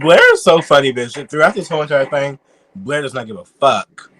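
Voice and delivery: loud, delighted gushing about Blair; loud excited fan rant. Real, not acted. Gushing about a funny debater.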